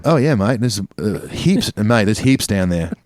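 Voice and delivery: Aussie banter voice; Australian accent. Pretend. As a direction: chat away in a broad Australian accent. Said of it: "you're pretty good at voices".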